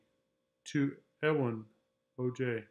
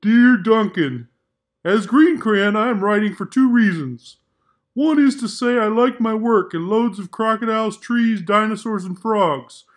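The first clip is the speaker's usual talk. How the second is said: louder, cheerful crayon writing a letter; content Green Crayon; 7 semitones higher, 11 dB louder than usual. Acted. Green Crayon writing contentedly to Duncan, louder. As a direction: sound pleased with your work, loud and friendly.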